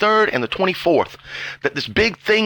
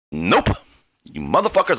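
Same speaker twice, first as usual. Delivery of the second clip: ordinary talk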